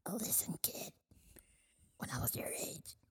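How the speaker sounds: put-on raspy whisper of a wise old smoker; smoker's voice. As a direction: breathe it out like a chain smoker. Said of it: "we have a smoker's voice".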